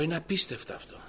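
marveling aloud at a miracle; astonished. Retold or imitated. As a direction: say it as if you can't believe it. Son astonished at father's change.

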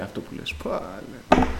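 whispered, stunned aside; hushed retelling. Retold or imitated. Preacher recalls being cut off mid-blessing, hushed. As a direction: drop to a whisper in disbelief. Retold rebuke, stunned reply.

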